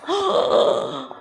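big storyteller's intake of breath; dramatic gasp. Acted. Storyteller reaches top of large hill, dramatic gasp. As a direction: gasp as if struck by the sight.

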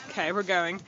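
ordinary talk

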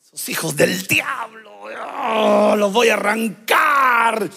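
growled shouted threat; angry threat. Acted. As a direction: snarl it like a persecutor. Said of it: "cuando yo le hago así".

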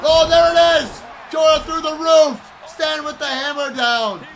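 high, shouted play-by-play; excited match commentary. Pretend. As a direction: call the action at a high-pitched, drawn-out yell. Excited shouting at the game action.

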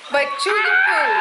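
sudden loud high call; shouting. Real, not acted. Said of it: "don't talk with your mouth full".

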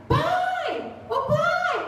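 high yelled call for dad; daughter calling out. Retold or imitated. Daughter calls father.

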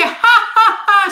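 high, slow delighted laugh through the words. Real, not acted. Host laughing at a viewer's crema comment.

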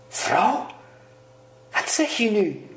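high-pitched incredulous question; startled disbelief. A narrator's reading. Husband jolted awake by his wife's demand, startled disbelief. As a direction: rise sharply on the first word, aghast.

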